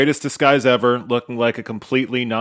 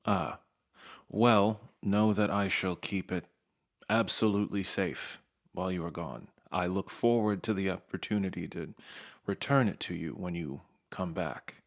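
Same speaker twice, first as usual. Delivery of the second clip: lore master voice; 5 semitones lower, 10 dB quieter than usual. Acted. GM voicing Lore Master Holland.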